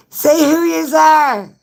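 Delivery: high, loud, drawn-out shout; calling out. Real, not acted. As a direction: holler it slowly to the whole room. Calling out to viewers.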